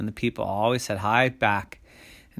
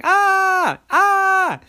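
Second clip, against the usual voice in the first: repeated high, loud shrieks; everyone screaming; 10 dB louder than usual. A narrator's reading. Everyone screaming at the door, panicked shrieks.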